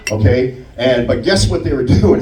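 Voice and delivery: normal talking voice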